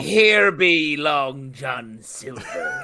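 drawn-out pirate bluster; pirate accent. Pretend. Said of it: "That's a pirate accent".

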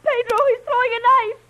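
high, loud cry of alarm; panicked, high-pitched yell; alarmed warning. Acted. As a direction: shout a frantic warning. Shouted warning of thrown knife.

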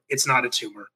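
underplay an action-hero line; Schwarzenegger without accent. Acted. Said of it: "doing his lines without the accent".

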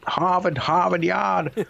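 put-on high, slow Boston accent with dropped r's; high-pitched parody of a Massachusetts accent; mock Boston accent. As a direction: drag out a hammed-up Boston "Hahvahd Yahd". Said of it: "the shouting starts, and they're like".

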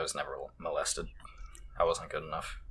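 near-whispered deadpan aside; quiet mutter. Real, not acted. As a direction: whisper it under your breath. Dark joke, muttered.

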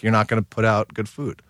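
ordinary talk, nothing marked

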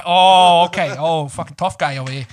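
sarcastic New York tough talk; angry New York accent. Acted. Speaker mocking a tough guy, pretend-angry New York accent. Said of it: "I'll just pretend to be angry".

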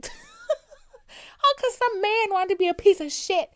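high, loud, slow laugh through the words; squealing incredulous laugh; laughing in disbelief. Real, not acted. Incredulous rant about absent father.

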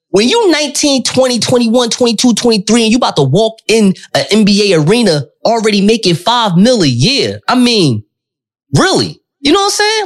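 excited. Real, not acted. Worked up about young millionaires.

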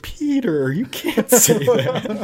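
laughing mom telling Peter off; mom scolding. Retold or imitated. Mom laughing while scolding Peter at the table. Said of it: "My mom's like".

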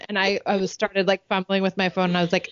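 normal talking voice

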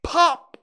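sudden loud, high "pop"; explosive, high-pitched pop; cork pop. A narrator's reading. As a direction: burst out like a cork from a bottle. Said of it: "just as if a cork".

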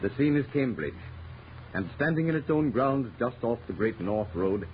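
usual speaking voice

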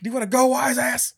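very high, very loud, laughing mock tough guy; tough-guy challenge. The cab driver picks a fight, hammed-up tough guy.

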